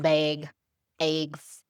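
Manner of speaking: "bag" and "eggs" with long Wisconsin ay vowels; deep Wisconsin accent. Shown as a demonstration. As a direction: imitate dairy-state Wisconsin talk, "bag, eggs". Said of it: "They talk like that".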